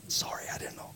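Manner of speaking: real whispered aside; apologizing off-mic in a whisper; whispered apology. Real, not acted. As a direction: whisper an embarrassed sorry. Phone interruption, apologizing aside.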